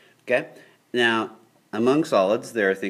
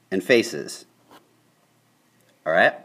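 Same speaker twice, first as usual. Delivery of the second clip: usual speaking voice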